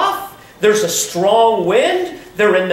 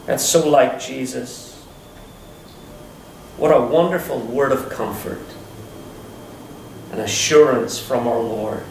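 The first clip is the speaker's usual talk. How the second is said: normal talking voice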